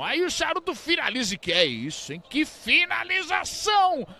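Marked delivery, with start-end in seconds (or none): 2.3-4.1 high, stretched admiring shout; excited exclamation. Real, not acted. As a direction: marvel at the finish. Praising the shot. (exclamação empolgada)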